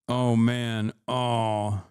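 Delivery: ordinary talk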